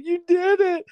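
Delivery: very high weepy squeal; mock tearful. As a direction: imitate a woman tearfully cheering someone on. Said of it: "she's crying".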